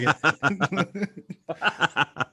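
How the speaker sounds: slow, laughing "yeah, fuck it"; drawn out through a laugh; laughing mock shrug. ScarJo giving up the accent, laughing and slow.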